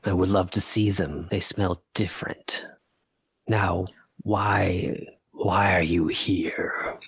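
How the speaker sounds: slow gravelly dragon, half whispered; a curious ancient beast, rumbling. Acted. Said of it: "this gravelly voice".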